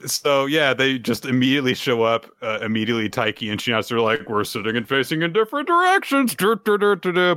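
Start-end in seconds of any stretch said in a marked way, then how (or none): 4.3-7.3 higher, hammed-up nothing-to-see-here voice; mock innocent cover-up. Podcaster voicing the caught couple facing different directions, mock innocent. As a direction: blurt it high, then trail into nonsense humming.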